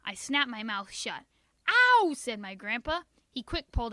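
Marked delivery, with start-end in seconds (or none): 1.7-2.1 high sharp ow of pain; loud ow. A narrator's reading. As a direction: yelp as the finger gets bitten. Grandpa's finger bitten.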